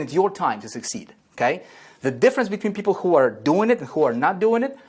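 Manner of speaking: usual speaking voice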